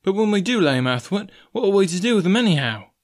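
put-on high young lad's questioning voice; Dick. A narrator's reading. Lad asking mutineers about the captives, high voice. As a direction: ask it high, quickening toward the end. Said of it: "asked Dick".

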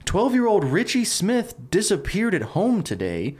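mock TV news bulletin delivery; news reporter voice. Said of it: "news reports saying stuff like".

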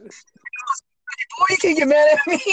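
high-pitched mock indignation; Daniel. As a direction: imitate his protest in a squeaky put-on pitch. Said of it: "he got mad at you for getting mad at him".

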